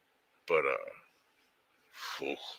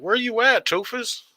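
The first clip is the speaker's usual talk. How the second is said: much higher, louder, playful hollering at a friend; teasing call-out; 7 dB louder than usual. Real, not acted. Streamer hollering at absent buddy Toofus, teasing. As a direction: rib him loudly, half joking.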